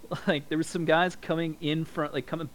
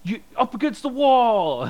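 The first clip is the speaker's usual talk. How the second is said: loud, high barked command imitating agents; mock shout; 10 semitones higher, 10 dB louder than usual. Border agents freaking out over a casing, mock shouted order. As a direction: yell like a cop overreacting. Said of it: "freaking out".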